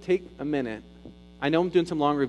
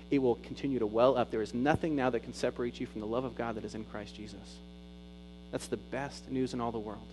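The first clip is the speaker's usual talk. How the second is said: low, soft, intimate preaching; quiet preacher's hush; hushed sermon; 3 semitones lower, 7 dB quieter than usual. Acted. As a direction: bring it down close and tender. Soft quoted promise and reflection.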